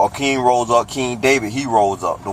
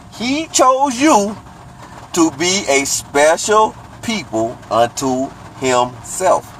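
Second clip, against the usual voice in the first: slow reading; 6 semitones higher, slower than usual. Shown as a demonstration. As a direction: spell it out slowly for listeners. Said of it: "So I'm gonna read it slow".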